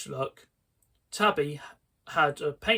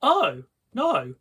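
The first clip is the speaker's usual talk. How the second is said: high, loud dismayed wail; 5 semitones higher, 5 dB louder than usual. Acted. Sheena seeing the ruined couch, wailing. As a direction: cry it out in dismay. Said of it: "Sheena wailed".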